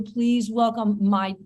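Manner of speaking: drawn-out announcer-style welcome, soaring on the name; guest introduction. Real, not acted. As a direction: stretch the name out like a show host. Host's show-style guest introduction.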